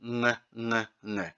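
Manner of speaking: Ng, ng hummed at the back of the throat; isolated ng. Shown as a demonstration. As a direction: hum the ng alone, no g release. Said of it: "the G sound at the back of the throat".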